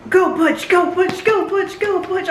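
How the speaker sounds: excited high chant of go Butch; cheering go Butch, pitch climbing. Real, not acted. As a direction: cheer him on, rising. Cheering on a character.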